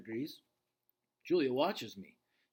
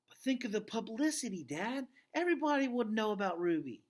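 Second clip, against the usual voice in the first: put-on a touch higher, eager young daughter; lightly voiced pleading girl; 8 semitones higher than usual. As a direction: plead like a hopeful kid. Girl pleads with her father.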